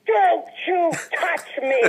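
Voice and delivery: slow, offended warning to the waitress; mock indignant. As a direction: draw it out like she's offended. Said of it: "She goes".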